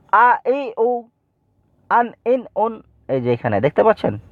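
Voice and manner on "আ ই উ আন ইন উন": high, level-toned syllable chant; Quran-primer drill on a raised note; quick recitation drill. Shown as a demonstration. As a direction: recite the short syllables for pupils to copy. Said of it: "তা আপনারা লক্ষ্য করে শুনুন".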